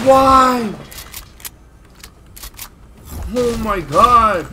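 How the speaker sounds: high, loud, drawn-out cry; dismayed wail. Real, not acted. Dismayed cry during play.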